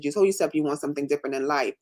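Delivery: ordinary talk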